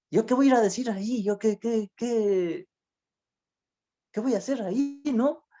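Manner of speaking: panicked self-doubt. Retold or imitated. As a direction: stumble over it like a scared former drunk. His panicked doubt voiced.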